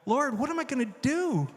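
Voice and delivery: high-pitched cry for help, re-enacted; retold desperate plea. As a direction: plead upward as if at a loss. Retold plea after losing editor.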